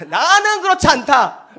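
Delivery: loud, high, drawn-out mock protest; hammed-up indignant denial; proud father. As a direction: declare it slowly and loudly like a huffy dad. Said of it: "자존감이 높은 아저씨들 이렇게 하죠".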